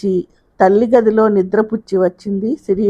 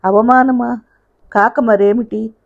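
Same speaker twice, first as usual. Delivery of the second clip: ordinary delivery, nothing marked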